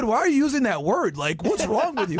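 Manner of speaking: high whiny mock of an offended kid. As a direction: squeak it like a prissy teen.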